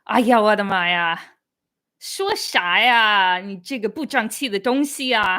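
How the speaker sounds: loud, scolding Dongbei auntie; Dongbei accent. Shown as a demonstration. Showing viewers Dongbei dialect, loud scolding Dongbei accent. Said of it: "And then the Dongbei accent".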